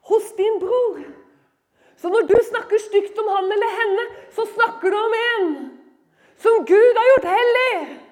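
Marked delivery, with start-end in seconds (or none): 2.0-8.0 forceful preaching. Real, not acted. Preacher defending believers as holy people, building in force. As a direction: drive it home, rising to a near shout.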